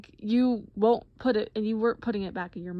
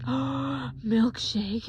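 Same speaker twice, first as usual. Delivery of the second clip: mock-thrilled, wide-eyed question; childlike excitement; 4 semitones higher than usual. Patient offered a milkshake, childlike excited question. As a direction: ask it like a delighted little kid. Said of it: "like a little five-year-old".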